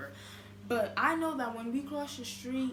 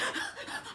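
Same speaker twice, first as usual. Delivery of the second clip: high gasp with a laugh; mock hyperventilating gasp; 12 semitones higher than usual. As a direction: gulp air like a sobbing girl. Imitating crying friend's gasps.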